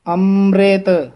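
ਅੰਮ੍ਰਿਤ spoken slowly and gently as amret; ਅੰਮ੍ਰਿਤ soft and drawn out; soft pronunciation. Shown as a demonstration. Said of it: "ਅੰਮ੍ਰਿਤ ਪਦ ਪੋਲਾ ਬੋਲਣਾ ਹੈ".

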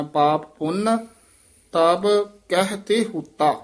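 ਪਾਪ ਪੁੰਨ, then a clear pause; pause after ਪੁੰਨ. Shown as a demonstration. Teacher showing where to rest, pausing after ਪੁੰਨ. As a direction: stop on ਪੁੰਨ before going on to ਤਬ. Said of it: "ਪੁੰਨ ਤੇ ਵਿਸਰਾਮ ਹੈ".

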